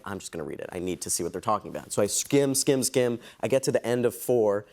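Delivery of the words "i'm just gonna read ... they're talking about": quiet resolve. Retold or imitated. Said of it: "And I'm like".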